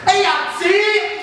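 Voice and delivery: high, slow, drawn-out "Hey, Yahtzee!" cheer; Italian guy cheering. Retold or imitated. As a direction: call it out like an excited Italian player.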